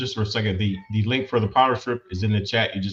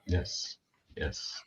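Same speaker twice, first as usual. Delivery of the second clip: whispering on the phone; 5 semitones lower, 13 dB quieter than usual. Real, not acted. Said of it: "I have to whisper this".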